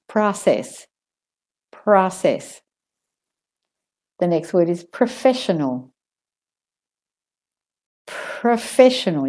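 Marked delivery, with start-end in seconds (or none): 0.1-2.6 "process" in American, "prah-sess"; American accent. Shown as a demonstration. Teacher models for learners, American "process". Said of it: "if you're doing the American". 8.1-9.3 higher "Professional" with a long puff after the p; aspirated P. Shown as a demonstration. Teacher models for learners, aspirated "Professional". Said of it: "still hear that aspiration".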